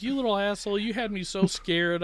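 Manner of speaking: high, fond teasing; relieved mock scold. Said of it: "I'm like".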